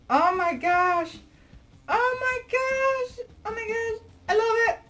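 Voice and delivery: real fangirl delight; gushing squeal. Real, not acted. Overwhelmed by the doll clothes.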